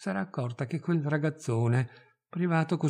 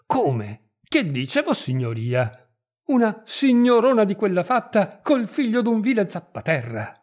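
incredulo, poi esplode più acuto e forte; sbalordito; 6 semitones higher, 5 dB louder than usual. A narrator's reading. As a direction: non crede alle sue orecchie. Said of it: "trasecolò di nuovo". (il mezzadro al padrone, sbalordito)

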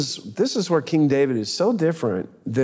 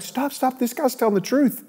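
much higher, urgent retold cry; alarmed crowd; 11 semitones higher than usual. Courtiers stopping drawn swords, crying out high in alarm.

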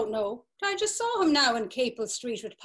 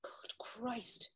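faint, hushed whisper sinking into the news; almost no voice, the truth settling in; stunned whisper; 15 dB quieter than usual. Acted. Alf whispers once he learns Paddy was buried this morning.

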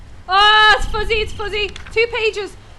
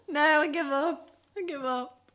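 defeated fading; 3 semitones lower, 5 dB quieter than usual. Real, not acted. Quitting the game.

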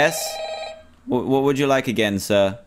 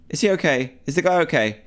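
normal talking voice

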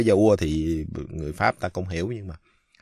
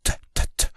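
unvoiced th puffs like a whisper; teacher demonstrating whispered final t; much faster than usual. Shown as a demonstration. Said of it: "nói nhỏ trong tai".

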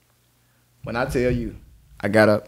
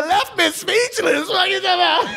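high, laughing through the words; laughing, talking to his friend about her. Real, not acted. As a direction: crack up while saying it. Laughing at the memory.

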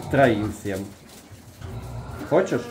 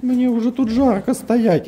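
high, slow, louder pig voice; nervous pig voice; 4 dB louder than usual. Pretend. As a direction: whine as the fretting pig.

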